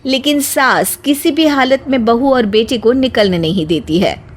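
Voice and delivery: plain reading voice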